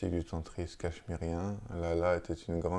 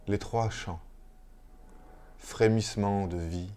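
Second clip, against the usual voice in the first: measured, solemn reading of a mystical chant; poem recitation. Acted. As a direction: recite line by line, with long pauses between phrases. Lit un chant mystique à voix haute. (lecture poétique, lente et solennelle)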